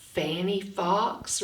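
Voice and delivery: "Fanny Fox" stretched slowly on the f; slow sound-out. Shown as a demonstration. Teacher sounding out "Fanny Fox" for young readers.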